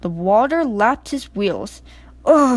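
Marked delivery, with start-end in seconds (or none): 2.3-2.6 sharp loud shivering oh. A narrator's reading. Percy as the water rises, loud shivering oh. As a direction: gasp at the cold. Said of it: "he shivered".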